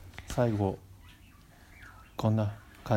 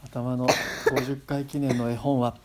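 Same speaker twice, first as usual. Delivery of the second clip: ordinary talk, nothing marked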